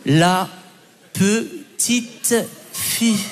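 stretched out, each syllable drawn long; mock dictation, absurdly slow; overly slow dictation. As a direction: drag the words out to the slow student.

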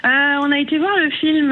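ordinary delivery

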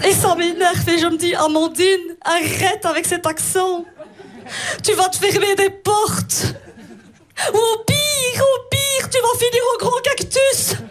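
put-on thick Belgian accent climbing to a high panic; annoyed Belgian accent. Comedian scolds herself over accent, mounting Belgian accent. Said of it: "Quand je m'énerve, mon accent belge revient très fort".

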